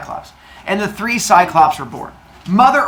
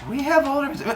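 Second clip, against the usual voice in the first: much higher, puzzled; surprised sons; 10 semitones higher, faster than usual. Retold or imitated. Sons learning of older brothers, high surprise. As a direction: wonder aloud, high. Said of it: "they're like".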